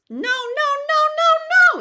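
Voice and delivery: very high, very loud call of No, no, no, climbing; childhood calling voice. Shown as a demonstration. Teacher demonstrating a childhood outdoor call. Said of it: "natural childhood calling outdoor voice".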